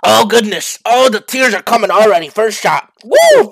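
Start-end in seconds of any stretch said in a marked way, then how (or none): 3.0-3.5 whoop. Real, not acted. After hot sauce shot.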